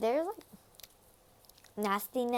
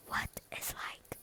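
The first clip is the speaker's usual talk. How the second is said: teasing whisper; 9 dB quieter than usual. Acted. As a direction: hush it like a secret. Teasing viewers to watch.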